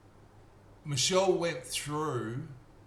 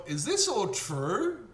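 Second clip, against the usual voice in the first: slow, raised voice of a surprised judge; judge's question; 7 semitones higher, 3 dB louder, much slower than usual. Retold or imitated. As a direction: draw it out like a doubting judge. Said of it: "and he's going".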